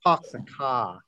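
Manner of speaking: put-on demonstrated Boston accent. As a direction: imitate a Bostonian dropping the r's. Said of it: "it might be Boston".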